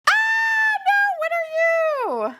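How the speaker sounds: stretched-out disappointed squeal; dismayed squeal. Real, not acted. Reacting to a disappointing pull.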